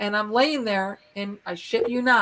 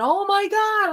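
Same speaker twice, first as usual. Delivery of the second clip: high, startled oh my God; suddenly spotting it behind you; startled gasp; 8 semitones higher, 3 dB louder than usual. Retold or imitated. Startled on spinning around.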